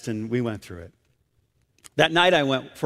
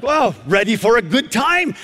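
amazed echo; 6 semitones higher than usual. Retold or imitated. Preacher acts out his amazed reaction.

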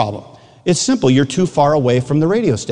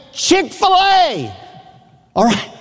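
yelling from far off; 11 semitones higher than usual. Retold or imitated. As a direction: stretch the words to carry across campus. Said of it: "I'm yelling at you".

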